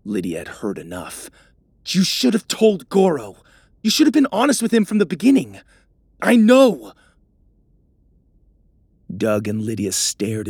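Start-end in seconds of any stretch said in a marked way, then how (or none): 1.9-5.6 scolding. Acted. Lydia scolds Doug. 6.2-6.9 loud snap. Acted. Doug snaps back.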